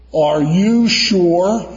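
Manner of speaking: slow, drawn-out stern question; stern judge. Retold or imitated. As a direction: lean on each word like a judge. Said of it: "leaned in with this judicial countenance".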